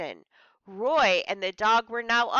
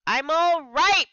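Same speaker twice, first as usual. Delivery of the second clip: high, loud reassuring yell; 7 semitones higher, 6 dB louder, slower than usual. Acted. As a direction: yell it to calm her. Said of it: "Briggs yelled to reassure her".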